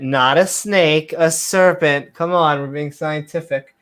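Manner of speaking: usual speaking voice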